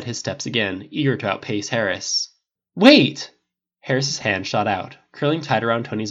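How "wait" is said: loud, high urgent call. A narrator's reading. Harris calling after Tony as he leaves, urgent shout. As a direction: shout after someone leaving.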